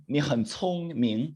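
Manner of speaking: very slow, 聪 and 明 drawn out; slow model phrase. Shown as a demonstration. Teacher modelling "you are smart" for class, very slow. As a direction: stretch each syllable for learners.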